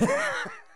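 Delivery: high burst of laughter; real high-pitched laugh; incredulous laugh. Real, not acted. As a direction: crack up at the absurdity. Laughs at the dark plot.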